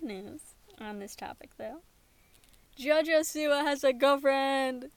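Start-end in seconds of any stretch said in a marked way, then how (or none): 2.8-4.9 loud, gleeful announcement; excited. Real, not acted. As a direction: shout the good news.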